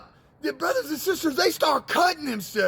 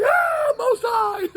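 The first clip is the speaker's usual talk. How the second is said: awed cry; 10 semitones higher, 4 dB louder, slower than usual. Acted. People falling down in worship.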